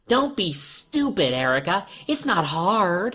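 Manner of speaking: slow, high irritated doll voice; annoyed. A narrator's reading. Doll girl losing patience with the boy, annoyed. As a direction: snap at him impatiently. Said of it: "Erica was getting annoyed".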